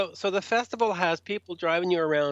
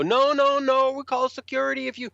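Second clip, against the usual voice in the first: alarmed official; 9 semitones higher than usual. Retold or imitated. Staff threaten tour manager with security, high and flustered. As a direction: imitate flustered staff threatening a big man.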